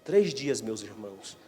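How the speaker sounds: ordinary delivery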